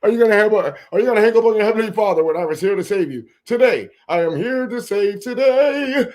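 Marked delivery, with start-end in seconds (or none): 3.4-6.1 mock preacher. Preacher voice to telemarketer.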